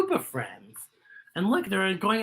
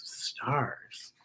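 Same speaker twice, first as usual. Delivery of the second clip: whisper; 6 semitones lower, 9 dB quieter than usual. A narrator's reading. Whispered word.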